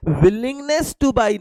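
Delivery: drawn-out key term. Shown as a demonstration. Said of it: "teacher stressing the key term".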